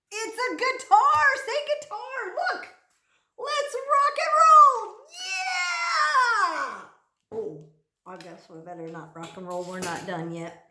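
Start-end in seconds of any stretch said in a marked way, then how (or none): 3.4-6.8 loud, high hammed-up rock star yell; rocker shout. Guitar picture, rocker shout. 7.3-10.6 sheepish aside. Real, not acted. Backing down after the shout.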